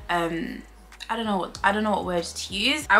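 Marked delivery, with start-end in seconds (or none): none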